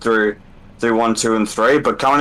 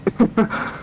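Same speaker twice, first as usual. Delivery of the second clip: breathy laugh; 10 semitones higher, 4 dB quieter than usual. Real, not acted. Driver laughs after tough race.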